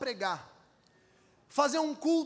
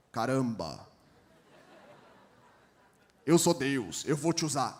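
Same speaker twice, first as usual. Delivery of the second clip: deep, much lower exasperated God; 10 semitones lower than usual. Pretend. God exasperated at Moses, deep and low.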